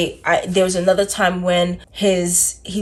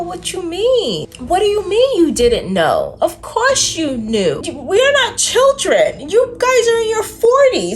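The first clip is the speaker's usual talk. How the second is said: higher and louder, outraged; indignant rising rant; 11 semitones higher, 4 dB louder than usual. Retold or imitated. Scolding the pair who claimed ignorance, building outraged rant. Angry retort to friends.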